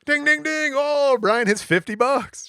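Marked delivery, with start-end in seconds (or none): 0.0-0.7 much higher, louder sing-song dings; slot machine dings. Retold or imitated. Husband winning at slots, high chiming dings. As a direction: chime like a paying slot.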